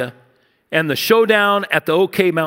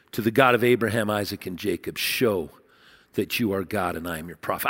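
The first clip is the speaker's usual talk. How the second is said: low, much softer, hushed plea; hushed prayer; 9 semitones lower, 6 dB quieter than usual. Acted. Prophet calls on God, hushed and low. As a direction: pray it in a near hush.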